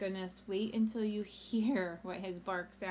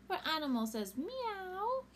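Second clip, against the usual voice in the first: ordinary delivery, nothing marked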